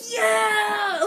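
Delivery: high, loud cheering whoop; retold cheer. Cam cheering after the fan shouts. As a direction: whoop in triumph.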